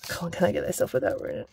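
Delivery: hushed, fast whisper while concentrating; nervous whisper. Real, not acted. Peeling a sticker carefully, scared, whispering fast. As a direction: whisper under your breath as you peel. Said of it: "I'm scared".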